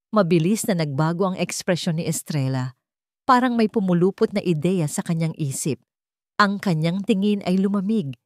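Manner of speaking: ordinary delivery, nothing marked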